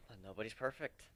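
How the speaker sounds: soft sigh, then quiet words; resigned sigh to a friend about mistakes. A narrator's reading. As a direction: let a sigh carry into the line. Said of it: "I let out a sigh".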